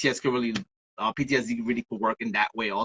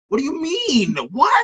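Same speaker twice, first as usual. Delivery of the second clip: high-pitched baffled protest, louder at the end; mock incredulity; 13 semitones higher, 4 dB louder than usual. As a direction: jump up high in acted disbelief. Acted retort to being blamed.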